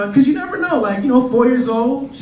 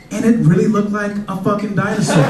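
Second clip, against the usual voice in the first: ordinary talk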